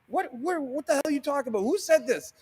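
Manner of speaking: high, fast disbelieving outburst; retold baffled what-the-hell; incredulous. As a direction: fire off the questions in shocked disbelief. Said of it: "I was like".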